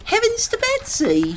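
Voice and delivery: high astonished waitress; shocked exclamation. A narrator's reading. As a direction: exclaim in surprise. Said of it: "she exclaimed".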